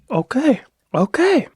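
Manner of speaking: okay rising high, impressed; startled by how good it is; pleasantly surprised. Real, not acted. Impressed by the tea.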